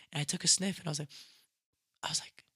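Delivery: usual speaking voice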